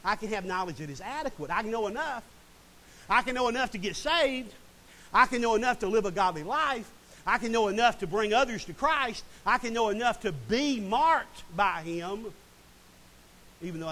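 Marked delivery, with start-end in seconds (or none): 1.5-12.3 louder, driving repetition; building preaching. Real, not acted. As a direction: preach it, growing with each line.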